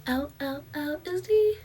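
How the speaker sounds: loud high ow, then slow drawn-out O'Reilly Auto Parts; ad jingle impression. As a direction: belt it like a radio ad. Imitating a radio ad jingle.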